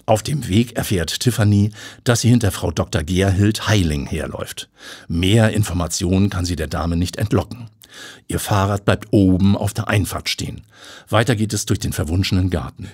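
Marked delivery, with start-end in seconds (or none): none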